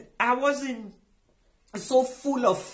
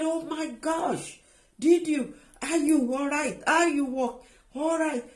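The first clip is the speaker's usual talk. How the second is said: higher-pitched, imitating a stunned daughter; gasping questions, retold in disbelief; astonished daughter; 5 semitones higher than usual. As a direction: act out the daughter's amazed concern. Astonished daughter sees mother walking.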